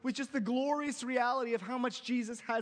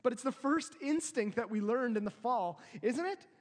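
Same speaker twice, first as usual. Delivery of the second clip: usual speaking voice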